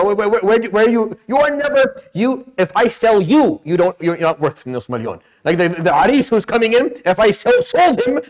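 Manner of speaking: high-pitched, stammering mock outrage; worked-up comic tirade at the groom; mock incredulity. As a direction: scoff in exasperated disbelief, pitch climbing.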